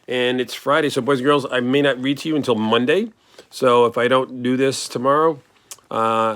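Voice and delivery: plain reading voice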